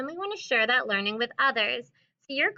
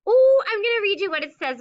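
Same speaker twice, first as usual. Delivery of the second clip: bright, loud, eager opener; excited; 9 semitones higher, 9 dB louder, faster than usual. Real, not acted. Teacher thrilled to show the class the cow page.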